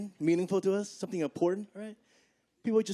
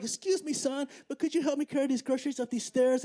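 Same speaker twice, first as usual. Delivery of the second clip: put-on old lady's polite request, higher pitched; frail old lady; 8 semitones higher than usual. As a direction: ask politely like an elderly woman. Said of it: "She was like".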